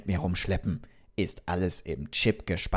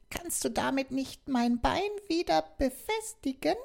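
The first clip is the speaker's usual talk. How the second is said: a curious little talking doll; Bonnie asking. Acted. Bonnie asks for repair.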